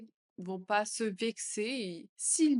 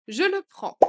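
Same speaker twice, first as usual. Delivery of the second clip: much higher and louder; 9 semitones higher, 8 dB louder than usual. Shown as a demonstration. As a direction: announce it brightly. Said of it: "phrase modelled twice". (beaucoup plus aigu et fort)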